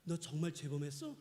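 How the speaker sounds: low, hushed voice of God; lightly voiced God, low and soft; God questioning a sinner. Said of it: "그럼 하나님 그러시겠죠".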